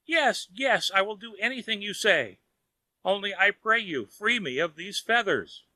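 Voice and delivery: high pleading cry; cried out. A narrator's reading. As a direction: beg desperately for release. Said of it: "cried out at last".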